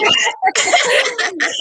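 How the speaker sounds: bright high laugh bursting out. Real, not acted. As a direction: laugh off the teasing. Laughs at being teased.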